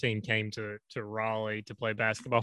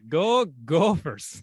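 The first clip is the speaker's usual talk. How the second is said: slow, louder Minnesota chant with a laugh; weird Minnesota accent; 3 semitones higher, 5 dB louder, much slower than usual. Retold or imitated. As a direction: stretch it out like an odd Minnesotan fan. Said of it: "I always go".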